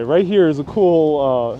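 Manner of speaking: usual speaking voice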